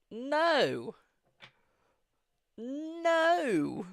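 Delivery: high, loud Brummie "no"; Birmingham accent. Shown as a demonstration. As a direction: stretch out a Birmingham "no", high and forceful. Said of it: "I used to have a Birmingham accent".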